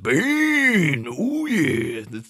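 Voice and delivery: high gravelly wrestler growl, oh yeah; Macho Man as Bane. Retold or imitated. Podcaster imitating Macho Man as Bane. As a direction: ham up a wrestling promo voice.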